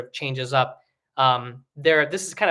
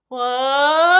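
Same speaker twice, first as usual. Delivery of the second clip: high whiny wah; whining baby sound; 12 semitones higher, 9 dB louder than usual. Shown as a demonstration. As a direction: whine like a small baby. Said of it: "sounds like a whining baby".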